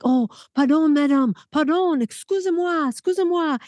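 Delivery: put-on light French accent, high and flustered; apologetic French. Startled French intruder apologizing in the cabin, light French accent. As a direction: back off politely in French. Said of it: "And he's like".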